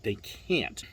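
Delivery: ordinary talk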